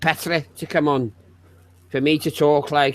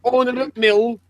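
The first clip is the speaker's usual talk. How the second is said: hammed-up Yorkshire rant; 3 semitones higher than usual. Hammed-up northern poverty rant.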